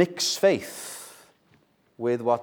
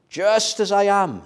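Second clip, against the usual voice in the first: higher, ringing Just as I am; pitched-up first take of Just as I am; lifted high; 8 semitones higher, 4 dB louder than usual. Acted. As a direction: raise Just as I am up high as a cry. Repeated hymn title, contrasted.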